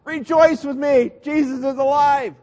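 a hammed-up happy invitation; mock jubilant. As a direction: burst out like a party host. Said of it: "he didn't say".

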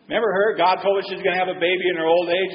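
normal talking voice

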